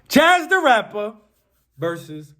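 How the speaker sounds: high, loud ring-announcer shout; fight announcer. Real, not acted. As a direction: announce the name like a title fight. Announces the battle's names.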